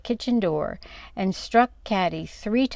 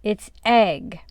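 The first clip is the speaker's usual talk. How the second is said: ominous; 4 dB louder than usual. A narrator's reading. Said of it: "in an ominous voice".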